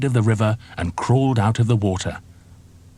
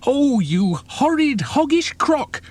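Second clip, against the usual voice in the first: much higher, slow outraged cry; furious monkey; slower than usual. A narrator's reading. Monkey scolding the crocodile, high and outraged. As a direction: shriek your disgust. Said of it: "cried Mugglewump".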